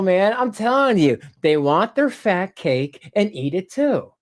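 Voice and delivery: ordinary talk, nothing marked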